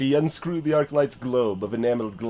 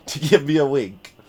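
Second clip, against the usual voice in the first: usual speaking voice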